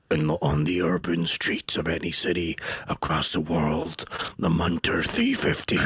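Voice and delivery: slow, low trailer narrator; deep dramatic trailer voice-over; movie trailer voice. Retold or imitated. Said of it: "in the movie star voice".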